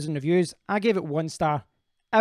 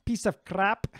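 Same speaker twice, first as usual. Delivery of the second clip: quick Scottish accent, pitched up; 4 semitones higher, slower than usual. Shown as a demonstration. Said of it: "in a Scottish accent".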